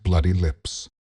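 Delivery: ordinary delivery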